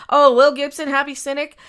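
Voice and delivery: ordinary talk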